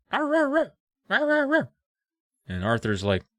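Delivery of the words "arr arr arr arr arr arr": high imitated barks; dog barking. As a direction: bark like dogs in the snow. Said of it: "they start barking".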